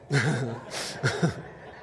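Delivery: real laugh. Real, not acted. Laughing at his own story.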